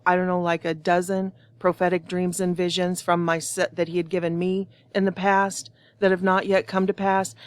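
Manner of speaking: ordinary delivery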